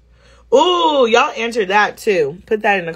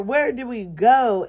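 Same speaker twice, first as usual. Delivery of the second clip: normal talking voice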